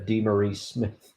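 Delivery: DeMaurice slipped into dee-muh-REESE; mispronounced name. Real, not acted. Co-host ranking union leaders, mispronouncing DeMaurice. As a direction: say DeMaurice wrongly, ending like "reese". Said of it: "you pronounced it wrong".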